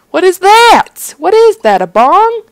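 high, loud incredulous questioning; shocked disbelief. Acted. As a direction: yelp it high and loud, appalled. Shocked at object in room.

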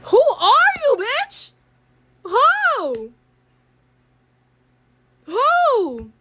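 high, slow, drawn-out indignant call; rising outraged who-are-you; loud challenge. Real, not acted. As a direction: stretch it out, calling her out loudly. Confronting the girl on live.

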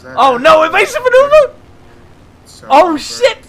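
high, loud alarmed cries; panicked shouting. Real, not acted. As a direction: cry out in real alarm. Dodging the plane in panic.

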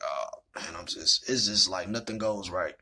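low, soft, defeated grumble; dejected. Retold or imitated. Sitting alone behind the Family Dollar, retold as low dejection. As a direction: sink down low, fed up. Retells slumping on generator, fed up.

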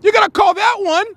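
high-pitched, pleading complaint; outraged protest. Real, not acted. Commentator appealing to the referee, high outraged protest. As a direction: protest it high, still worked up.

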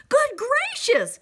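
high, loud startled exclamation; storybook bird taken aback; shocked gasp. A narrator's reading. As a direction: gasp it out in astonishment. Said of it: "gasped Maisie".

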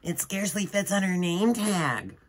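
high mocking girl voice; teasing schoolgirl. A narrator's reading. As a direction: sneer it to the class. Rita teases.

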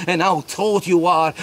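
usual speaking voice

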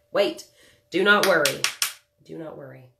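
usual speaking voice

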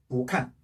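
"보" rising, "칸" falling in fourth tone; weak-strong tones on "보칸"; second tone then fourth. Shown as a demonstration. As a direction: lift "보", then drop "칸". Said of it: "사성이 왔어요".

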